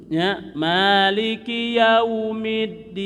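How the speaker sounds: Maliki yaumiddin slow, lips closing on mim, open on nun; careful recitation. Shown as a demonstration. Teacher recites the full verse as a model. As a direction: recite slowly, close for mim. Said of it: "jangan ditutup".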